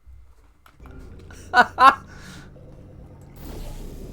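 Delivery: wheezing laugh with no voice; breathy silent laugh. Real, not acted. Gamer wheezing at his own mistake, breathless laugh.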